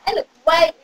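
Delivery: usual speaking voice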